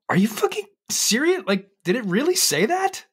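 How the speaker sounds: mock astonishment at a child's words; incredulous. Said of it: "I go".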